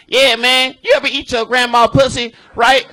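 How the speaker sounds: loud, high, brash stage delivery; retold over-the-top joke. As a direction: shout the crude opener at the crowd. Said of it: "just going off the top".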